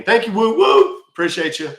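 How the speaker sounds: loud, high-pitched burst of thanks; excited shout-out. Real, not acted. Streamer thanking a viewer for a gift, excited shout-out. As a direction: whoop out your gratitude.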